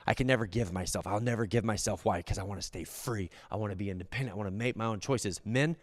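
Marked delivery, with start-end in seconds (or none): 0.0-2.0 low, quiet stubborn refusal; a hint of a stubborn holdout, muttered low; voiced resister. Resister to Jesus, quietly stubborn. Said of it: "what you're saying is the same thing". 2.2-5.4 low, soft self-willed mutter; quiet stubborn voice. Retold or imitated. Resister explaining why, soft and low. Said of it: "what you're saying is the same thing".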